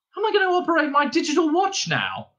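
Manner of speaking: high, quick panicky question; frightened dismay. A narrator's reading. Arthur losing his arm, fretting over watch, frightened dismay. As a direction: rush it out in high-pitched dismay. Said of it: "A frightening thought struck him".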